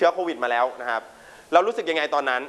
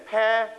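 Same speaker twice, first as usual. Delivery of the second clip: "pair" stretched out on its own; stress on first syllable; 5 semitones higher than usual. Shown as a demonstration. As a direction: punch out "pair" as the stressed opening of Parasite. (เน้นเสียงพยางค์แรก "pair")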